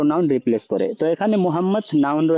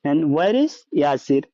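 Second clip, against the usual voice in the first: slow translation; much slower than usual. Shown as a demonstration. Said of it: "ওয়া আইনা ইয়াসির".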